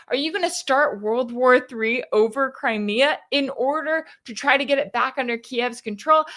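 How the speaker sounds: ordinary talk, nothing marked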